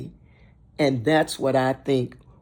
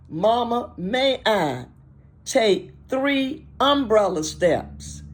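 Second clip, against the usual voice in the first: put-on higher, drawn-out request in a children's game; player asking Mama; 6 semitones higher than usual. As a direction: call out for permission, stretching the umbrella steps.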